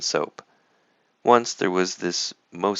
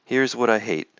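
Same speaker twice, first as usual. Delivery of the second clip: usual speaking voice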